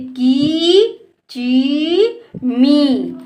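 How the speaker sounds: की, ची, मी each slowly drawn out; long ee syllables. Shown as a demonstration. Teacher recaps the sounds for pupils, each one stretched. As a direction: recite की, ची, मी with long vowels. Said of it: "इस तरह से हम इसका उच्चारण करते हैं".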